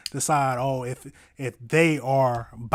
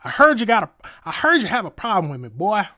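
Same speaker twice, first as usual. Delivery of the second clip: raised, louder mock Southern accent; 6 semitones higher, 5 dB louder than usual. Streamer mimicking the judge's threatening call, Southern accent. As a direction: put on a Southern accent, pitched up. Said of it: "Y'all say it in a Southern accent".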